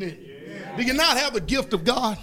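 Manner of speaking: normal talking voice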